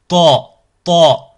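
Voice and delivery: Ta loud and heavy, vowel darkened towards "To"; emphatic T. Shown as a demonstration. Teacher demonstrating the emphatic letter Ta, loud and heavy. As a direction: strike an emphatic, backed Ta. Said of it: "Pareil, son emphatique".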